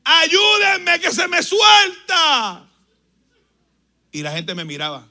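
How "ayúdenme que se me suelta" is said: high, loud, slow cry for help; shouting for help while holding the man down; desperate shout for help. Retold or imitated. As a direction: yell for help as he slips away. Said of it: "Y en una le digo".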